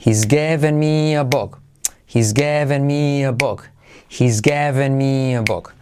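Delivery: slow clear model sentence; repeat after me. Shown as a demonstration. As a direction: drill it slowly for students to copy. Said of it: "Repite conmigo". (frase para repetir despacio)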